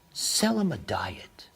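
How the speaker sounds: slow conspiratorial whisper. Real, not acted. Whispering the sales trick to listeners. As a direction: whisper it like a trade secret.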